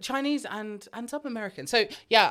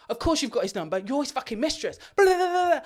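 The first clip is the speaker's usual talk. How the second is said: higher, louder hammed-up accusation; jealous accusing bride; 8 semitones higher, 5 dB louder than usual. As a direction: sneer it, then screech off. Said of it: "bride's like".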